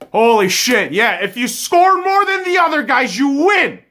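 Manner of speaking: loud, high, disbelieving yell; exasperated shout. Real, not acted. Hockey fan blowing up at his team's play, a loud disbelieving yell. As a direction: blow up at the team in disbelief.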